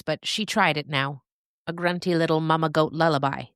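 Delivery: ordinary delivery, nothing marked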